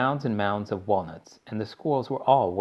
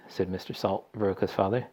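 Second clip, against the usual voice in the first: plain reading voice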